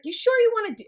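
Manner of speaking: mother steering her son, high and coaxing; mock leading question. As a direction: nudge him off his choice, sing-song. Mother steering son's choice.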